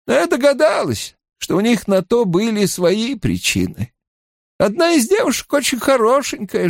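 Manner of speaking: high-pitched, careful recollection; guarded healer woman. Acted. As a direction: describe them as a watchful matron. Narrator voices woman recalling girls.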